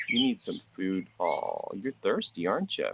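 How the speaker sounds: ordinary talk, nothing marked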